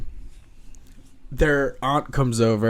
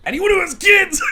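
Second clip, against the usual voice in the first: startled; 7 dB louder than usual. Real, not acted. Cat attacks mid-sentence.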